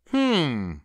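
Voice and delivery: high, musing rabbit hum; lightly voiced puzzled rabbit; pondering rabbit. A narrator's reading. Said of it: "thought the rabbit".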